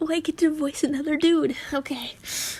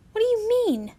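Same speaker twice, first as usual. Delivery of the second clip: high-pitched, sneering question; sneered; 9 semitones higher than usual. A narrator's reading. Ginny challenging Harry, sneering. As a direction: throw it back with a sneer, pitched up. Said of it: "she snared".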